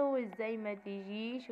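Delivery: slow, drawn-out imitation of a complaint; mock upset reproach. Friend reproaches husband for missing engagement, slow mock-offended complaint. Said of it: "وصاحبه زعل منه".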